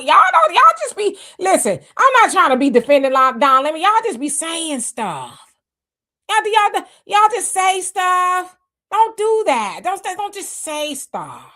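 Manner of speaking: worked-up lecture to chat; heated scolding. Real, not acted. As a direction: scold the chat for just saying stuff. Scolding chat for saying stuff.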